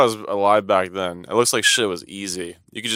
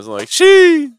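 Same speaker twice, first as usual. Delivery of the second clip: slow, much higher wailing "she"; imitated meme wail; meme shriek; 7 dB louder, much slower than usual. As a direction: drag out the shrill meme cry.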